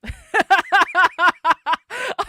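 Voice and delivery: loud, high burst of laughter; real cackling, loud and rising; big laugh. Real, not acted. Streamer reading the chat's gummy votes, bursting into laughter.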